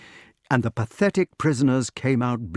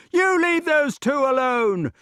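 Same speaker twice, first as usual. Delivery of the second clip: high-pitched indignant protest; high crowd-of-prisoners voice; defiant prisoners; 3 dB louder than usual. A narrator's reading. Prisoners defend the two.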